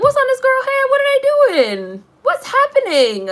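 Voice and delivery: very high, squealed disbelief; squeaky, incredulous mock wail; baffled outcry. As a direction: exclaim high and loud, drawing out the end. Retelling own reaction to actress's hair.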